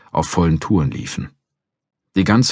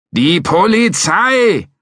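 insistent shout; 5 dB louder, much slower than usual. Acted. McCracken shouting back.

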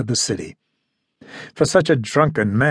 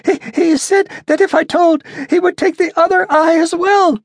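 frightened stammer; 4 dB louder than usual. A narrator's reading. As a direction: stutter it out, scared stiff. Terrified captive stammering.

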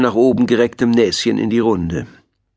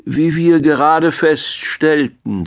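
slow, higher schoolteacher voice; experienced teacher; 6 semitones higher, slower than usual. A narrator's reading. Owl addresses the squabbling animals, experienced teacher's slow lecture. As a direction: address the class with measured authority. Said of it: "sprach auch wie eine erfahrene Lehrerin".

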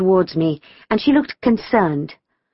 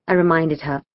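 ordinary delivery, nothing marked

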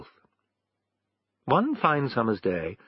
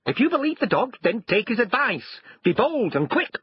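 much higher-pitched, urging voice; bossy wife; 12 semitones higher than usual. A narrator's reading. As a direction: push the husband into action. Wife urges husband to act.

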